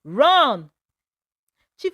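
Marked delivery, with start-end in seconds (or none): 0.0-0.7 a loud panicked yell; scream. A narrator's reading. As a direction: shout it out in terror to your friend. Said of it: "Adaeze screamed".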